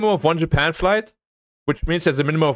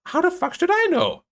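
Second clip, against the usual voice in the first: much higher, squeaky indignant retort; incredulous mock outburst. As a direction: throw the question back in disbelief. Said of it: "I'm like".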